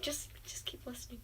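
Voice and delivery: a hushed whisper. Real, not acted. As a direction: whisper back to the friend. Friend interrupts the story.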